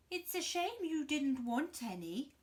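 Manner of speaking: mock sympathy; loud sly crocodile. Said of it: "said the crocodile".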